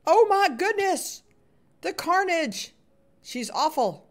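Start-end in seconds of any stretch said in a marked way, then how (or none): 0.1-2.7 high, drawn-out oh my goodness; panicked at the attacker; scared gasp. Real, not acted. Gamer startled by a vicious player.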